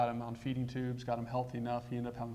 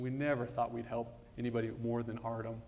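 normal talking voice

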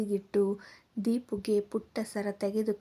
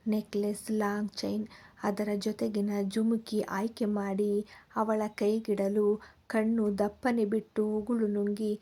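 plain reading voice

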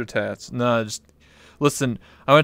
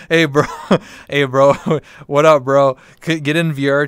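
calling out; 2 semitones higher, 7 dB louder than usual. Pretend. Calling out to [name].

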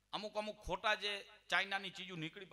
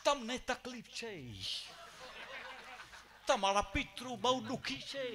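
a touch of a phony possessed bhuva intoning doom; mock fake medium; 5 semitones higher than usual. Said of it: "આવા વાસા નીકળશે".

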